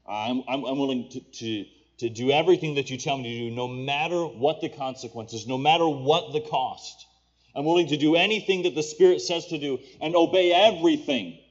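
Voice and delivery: impassioned prayer. Acted. Preacher voicing a willing heart's vow to the Lord, impassioned. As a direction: build into a forceful vow of surrender.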